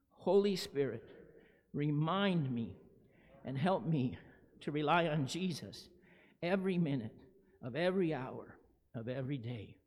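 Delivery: group prayer. Real, not acted. Leads congregation in prayer.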